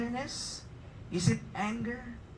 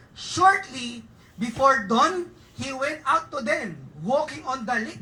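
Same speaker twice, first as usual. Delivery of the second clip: very slow, louder reading; scripture reading; 7 dB louder, slower than usual. Acted. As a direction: read it out slowly and strong.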